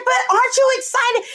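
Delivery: high, quick retold plea; hammed-up eager question; eager pleading. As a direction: push for excitement, high and fast. Retelling her eager question to son.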